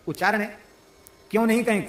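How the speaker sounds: ordinary talk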